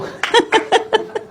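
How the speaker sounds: high breathy burst of laughter. Real, not acted. Listeners laughing at the story. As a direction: let out a high airy laugh.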